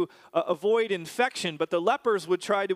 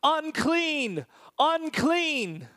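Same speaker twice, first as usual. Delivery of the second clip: high, drawn-out cry falling at the end; yelled warning; 9 semitones higher, much slower than usual. Acted. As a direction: call out a warning like an outcast. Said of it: "They would have to yell".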